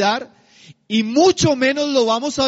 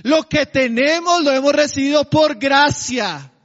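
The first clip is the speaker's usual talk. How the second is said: fervent preaching; 3 semitones higher than usual. Real, not acted. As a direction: press each word home to the church. Preaching climax.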